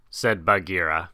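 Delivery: plain reading voice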